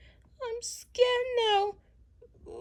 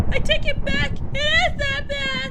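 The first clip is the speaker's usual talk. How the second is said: louder, higher, rushed in fright; panicked fear; 7 semitones higher, 8 dB louder than usual. Acted. Nina to Chucky after thunder, louder and panicked. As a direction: blurt it out, still shaken.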